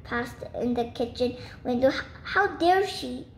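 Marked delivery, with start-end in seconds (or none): 1.9-3.3 higher-pitched outraged exclamation; affronted, stumbling into the words; indignant. A narrator's reading. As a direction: sputter it in mild outrage. Bird outraged at intruders.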